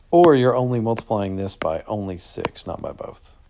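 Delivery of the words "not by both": whispered aside. Real, not acted. Teacher aside.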